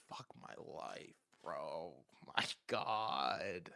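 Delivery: soft, unvoiced aside of dread; muttered despair. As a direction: breathe it out under your breath. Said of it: "And I'm like".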